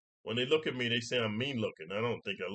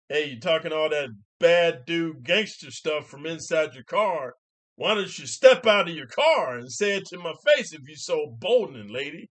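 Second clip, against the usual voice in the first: confrontational challenge; 4 semitones higher, 9 dB louder than usual. Acted. Man in his head challenging lady in car, loudly confrontational. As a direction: call her out, voice rising loud.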